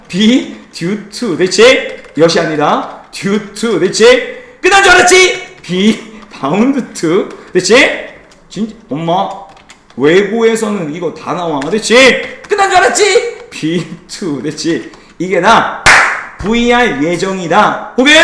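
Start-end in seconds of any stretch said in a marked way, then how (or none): 4.6-5.6 very high, loud playful gotcha; teasing gotcha. Pretend. Teacher springing yet another item on class, gleeful gotcha. Mock gotcha as list keeps growing. 12.5-13.5 very high, playful gotcha; teasing gotcha. Pretend. Teacher springing the last item on class, gleeful gotcha. Mock gotcha as list keeps growing.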